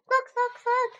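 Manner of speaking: very high squeaky hamster cry; squeak. A narrator's reading. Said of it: "I squeaked".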